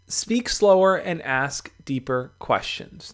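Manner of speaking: slow and spaced, each word given weight; slowed-down announcement of the rule; slow, deliberate. Shown as a demonstration. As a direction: lay out the tip slowly, like a headline. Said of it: "let me explain this one".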